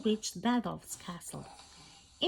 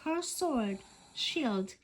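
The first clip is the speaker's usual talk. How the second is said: usual speaking voice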